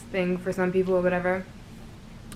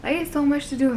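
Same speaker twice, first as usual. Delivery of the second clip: high, drawling Southern country voice, incredulous; Southern country accent asking how they could be bored; 8 semitones higher than usual. Retold or imitated. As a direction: play the North Carolina friend in a thick country accent. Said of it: "that's my country accent".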